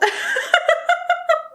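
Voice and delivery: long, high burst of laughter; high-pitched laugh. Real, not acted. Laughs at the awful product.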